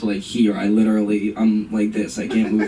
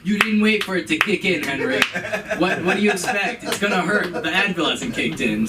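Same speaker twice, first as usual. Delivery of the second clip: impatient mom; 6 semitones higher than usual. Retold or imitated. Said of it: "She's like".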